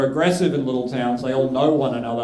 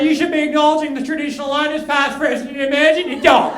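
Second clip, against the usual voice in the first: high-pitched indignant scolding, getting louder; yelling bikie; 10 semitones higher, 3 dB louder than usual. Retold or imitated. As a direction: rant a solemn acknowledgement like an angry bikie. Acts the yelling bikie being progressive.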